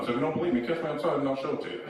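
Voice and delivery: ordinary talk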